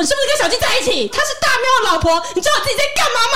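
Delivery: high, rapid accusation; furious accusation. Retold or imitated. Wife on the phone accusing her husband, high-pitched rapid fury. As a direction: fire the questions at him fast and high, fuming. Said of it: "我超生气".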